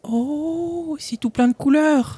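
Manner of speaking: high, drawn-out marvelling; childlike wonder. Acted. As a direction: gasp at it like a delighted child.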